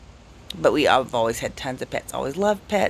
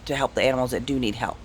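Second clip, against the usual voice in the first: normal talking voice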